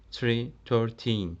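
put-on teacher contrasting the number and its teen form; slow teaching demo. Shown as a demonstration. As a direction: lay out "Three" then "thirteen" slowly for the class. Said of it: "Sonuna ... thirteen getiriyoruz".

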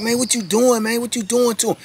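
high, urgent yelled protest; hollering. Retold or imitated. Inmates yelling at the sergeant to stop, high urgent holler. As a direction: shout at the guard to stop. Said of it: "we hollering and screaming like".

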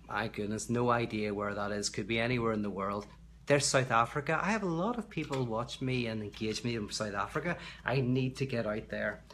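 usual speaking voice

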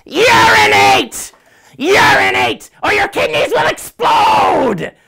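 high-pitched, full-force panicked shout. Acted. Rick standing in the plane aisle, shouting at alarmed passengers. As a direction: yell it out far above your talking voice. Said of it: "and shouts".

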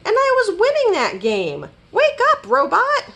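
high complaint building to a shout; wailing. A narrator's reading. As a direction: whine up high, then yell to wake him. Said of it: "wailed Rabbit".

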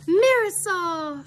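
high, drawn-out name greeting; delighted exclamation. Acted. Molly meets her friend's daughter, exclaiming. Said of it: "she exclaimed".

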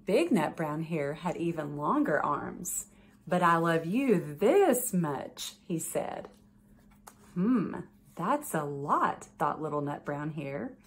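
gentle bedtime story read-aloud; reading to children. A narrator's reading. Judge reading a picture book to children, gentle storytelling.

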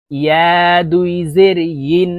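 ইয়া drawn out with a y glide, ইন as yin; clear ya, not hamza. Shown as a demonstration. Teacher correcting learners, ইয়া and ইন with a y, no glottal catch. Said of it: "চারটি হরফের উচ্চারণ চারভাবে হবে".

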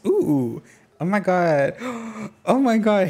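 can't contain the excitement; excited gasp. Real, not acted. Streamer thrilled by the picnic offer, excited.

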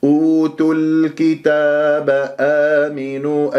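أوتوا الكتاب آمنوا slow, "ūtul-kitāb" with sounded lām; joined tajweed recitation. Shown as a demonstration. Teacher models the verse, joined slow recitation with clear lām. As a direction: chant أوتوا الكتاب آمنوا with measured long vowels. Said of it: "تنطق اللام من الكتاب والشفاه مضمومة".

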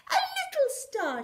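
a high, delighted cry; excited cry. A narrator's reading. Rocket spotting a tiny light, delighted high cry. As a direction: call out, thrilled at finding it. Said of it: "she cried".

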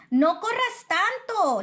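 calling out after him. A narrator's reading. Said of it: "le grita".

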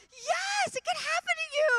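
an ecstatic, tearful yes; overjoyed cry. Retold or imitated. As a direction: burst out with joy. Emotional shouted yes.

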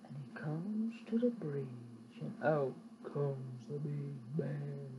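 low, slow and soft; slow down and drop low for suspense; hushed storyteller building dread; ominous hush. A narrator's reading. Ominous narration of bear.

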